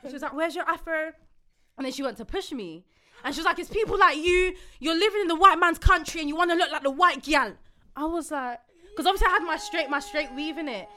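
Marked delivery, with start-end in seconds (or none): 0.3-1.1 raised, higher-pitched challenge; retold shouting. Stranger repeats question about hair, retold raised voice. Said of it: "you're now shouting at me". 3.6-7.5 high, loud accusing rant; retold shouting. Stranger berates woman over straight hair, loud retold rant. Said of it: "you're now shouting at me".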